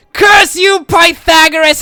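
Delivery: high, loud villain cursing; villainous shout. Acted. Cultists cursing Pythagoras, hammed-up villain shout.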